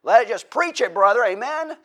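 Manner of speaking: loud, high preacher burst; letting it rip. Shown as a demonstration. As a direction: preach it full force for a moment. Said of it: "we like to let it rip".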